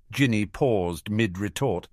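plain reading voice

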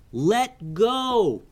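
put-on high boyish voice calling upward; yelled up. Kaleo calling up to Kavi in the air: high urgent yell. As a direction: call out high to a friend hanging above. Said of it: "Kaleo yelled up to Kavi".

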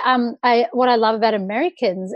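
usual speaking voice

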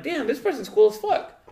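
high, loud burst of delight; excited quote. Retold or imitated. Meeting someone new months later, excited high outburst. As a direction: blurt it out, amazed by someone new. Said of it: "and you're like".

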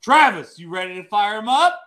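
loud and high hype call. Real, not acted. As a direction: fire up the crowd. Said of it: "clears throat".

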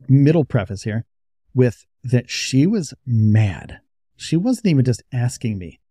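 normal talking voice

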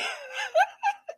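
high-pitched amused laugh. Real, not acted. Host amused by a viewer's comment, laughing high.

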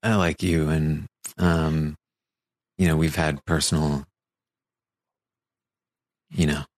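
halting, low-voiced fumbling with long pauses; mock an awkward talk, petering out between phrases; awkward heart-to-heart. David awkwardly offering Danny support, halting and trailing off. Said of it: "It was such an awkward conversation".

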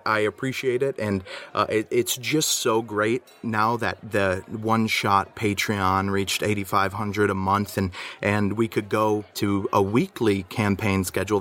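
usual speaking voice

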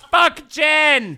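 loud, high outburst of disgust; emphatic shout. Real, not acted. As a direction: yell it as a verdict. Rejecting the gin loudly.

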